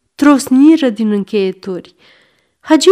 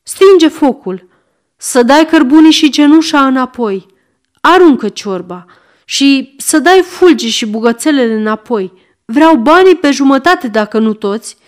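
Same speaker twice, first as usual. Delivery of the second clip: frantic shout; 3 semitones higher, 4 dB louder than usual. Acted. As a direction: shout the demands desperately. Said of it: "îi strigă". (strigăt disperat)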